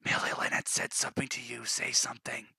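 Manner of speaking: urgent whisper. Real, not acted. GM whispering to prompt Millie to reply. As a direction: hiss it under breath to prompt her. Urging a silent player.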